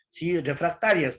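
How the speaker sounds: ordinary talk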